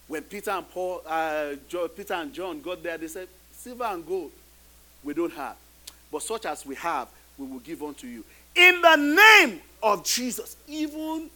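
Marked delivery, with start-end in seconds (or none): none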